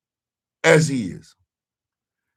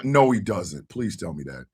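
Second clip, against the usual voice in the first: ordinary talk, nothing marked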